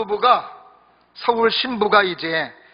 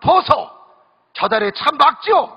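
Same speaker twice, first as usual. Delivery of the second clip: Gyeongsang bride; 6 semitones higher than usual. Retold or imitated. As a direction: call out to him like a country Gyeongsang wife. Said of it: "경상도 신부가 이 물어봅니다".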